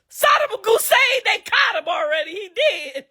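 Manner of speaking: high, loud mock yell of the news; mock shout. Shouting at her husband to wake him, mock yell. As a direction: blurt it out to jolt him awake. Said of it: "you wake that motherfucker up and you tell him".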